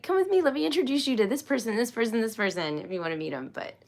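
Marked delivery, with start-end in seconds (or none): 0.0-2.8 fast, higher, bubbly hostess voice; eager introducer. Retold or imitated. Playfully imitating herself introducing people, fast and high. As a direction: rush them along, mock eager. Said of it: "I'll be like".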